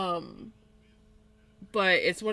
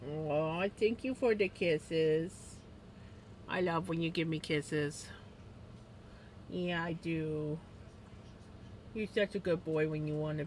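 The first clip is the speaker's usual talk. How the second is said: tender pet talk; 4 semitones lower, 7 dB quieter than usual. Real, not acted. Owner softly thanking her dog for kisses, tender.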